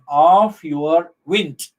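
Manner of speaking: slow OF YOUR leading into a stressed WIND; measured scansion, weight on WIND. Shown as a demonstration. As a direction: draw out the foot, landing on WIND. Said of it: "stress on the first".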